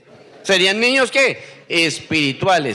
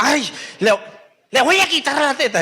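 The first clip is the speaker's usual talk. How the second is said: higher, exasperated mock mother; mother's complaint; 7 semitones higher than usual. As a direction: play the fed-up nursing mom, pitched up. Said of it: "le dice la mamá".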